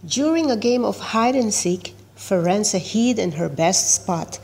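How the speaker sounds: plain reading voice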